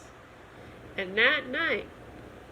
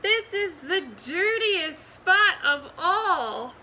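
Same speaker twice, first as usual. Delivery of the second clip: dismayed; 7 semitones higher, 5 dB louder than usual. A narrator's reading. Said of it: "cries the farmer's wife".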